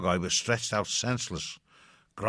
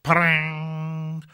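sound effect; 7 semitones higher, 5 dB louder than usual. A narrator's reading. Sound of pebble hitting blade.

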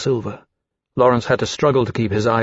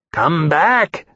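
higher, rising call after someone; 4 semitones higher than usual. A narrator's reading. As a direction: call it back across a distance. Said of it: "Temeraire called".

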